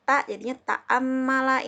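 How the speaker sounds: ta'ammala said slowly and clearly, each syllable apart; slow classroom pronunciation of ta'ammala; slow letter demo. Shown as a demonstration. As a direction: model ta'ammala slowly for students. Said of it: "Ini contohnya ta'ammala".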